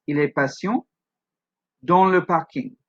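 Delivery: "Il est patient" rising, pause, "dans le parking" falling; two sections. Shown as a demonstration. Teacher showing two sections, "patient" rising, "parking" falling. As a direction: split the French sentence, up on "patient", down on "parking". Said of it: "We have two sections of the sentence".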